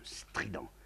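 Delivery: ordinary delivery, nothing marked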